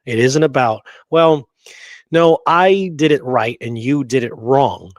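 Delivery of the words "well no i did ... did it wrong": normal talking voice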